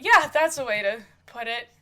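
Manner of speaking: loud, amused agreement; light laughing reply. Acted. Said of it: "Christopher kinda laughs, going".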